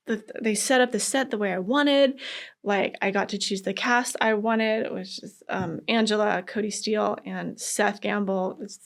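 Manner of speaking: ordinary talk, nothing marked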